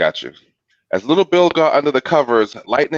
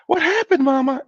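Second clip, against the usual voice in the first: put-on very high little boy; Little Bill's child voice. A narrator's reading. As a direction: ask like a startled small child. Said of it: "asked Little Bill".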